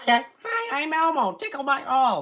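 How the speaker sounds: high, squeaky Elmo voice. Retold or imitated. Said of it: "in an Elmo voice".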